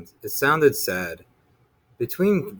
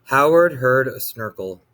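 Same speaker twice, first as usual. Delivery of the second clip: ordinary delivery, nothing marked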